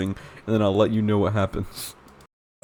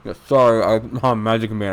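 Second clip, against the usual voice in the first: usual speaking voice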